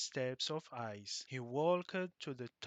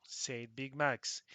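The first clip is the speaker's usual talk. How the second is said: ordinary delivery, nothing marked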